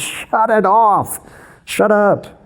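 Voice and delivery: high, slow commanding rebuke, then clipped; stern rebuke. Retold or imitated. Jesus silences the yelling demon, sharp high rebuke. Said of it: "and he goes".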